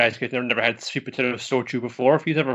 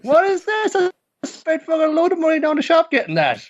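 shrill outraged squeal, much higher; outraged high shout; 5 dB louder than usual. Retold or imitated. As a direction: shriek it like a spoiled rich guy.

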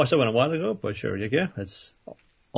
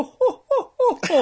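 very high, louder mock villainess "ho ho ho" laugh; 4 dB louder, slower than usual. Describing the purple-haired villain, a high haughty laugh. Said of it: "she has the oh".

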